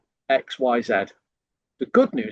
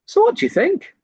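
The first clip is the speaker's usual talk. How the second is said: much higher, bright questioning "So what do you think?"; salesman trial close; 4 dB louder than usual. Shown as a demonstration. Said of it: "for example".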